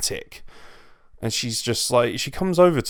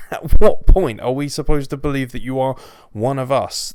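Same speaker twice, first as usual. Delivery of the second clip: loud, exasperated demand; exasperated shout; 2 semitones higher, 10 dB louder than usual. Real, not acted. Vlogger ranting at Becky Lynch, loud and exasperated. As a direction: vent the frustration at full volume.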